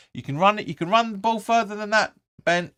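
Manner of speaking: yelled high and loud at the screen; frustrated fan shouting at the telly; shouting at the player. Real, not acted. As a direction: urge him on, loud and high.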